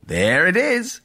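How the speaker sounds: bright, breezy railroad conductor voice; a jovial conductor shrugging it off; cheerful old conductor. A narrator's reading. Conductor finds the time.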